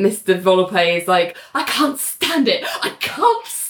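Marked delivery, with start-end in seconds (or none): 1.5-3.7 high-pitched mock exasperation; irritated outburst. Mister Volpe fed up at the courthouse, hammed-up irritation. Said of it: "is getting really irritated".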